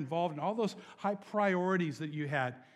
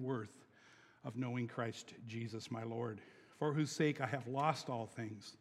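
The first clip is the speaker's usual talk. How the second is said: scripture reading; 4 semitones lower than usual. Acted.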